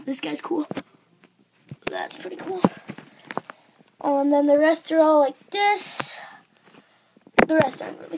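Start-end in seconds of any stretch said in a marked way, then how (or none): 0.1-2.6 whisper. Real, not acted. Whispered aside about card.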